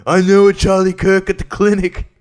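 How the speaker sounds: mock a confused YouTuber as a fool; hammed-up dopey caricature. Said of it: "making fun of ... in that kind of voice".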